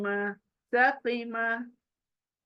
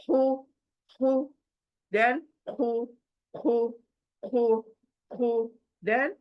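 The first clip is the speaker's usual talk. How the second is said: ordinary talk, nothing marked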